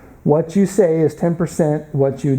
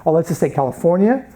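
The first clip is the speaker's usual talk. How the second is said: usual speaking voice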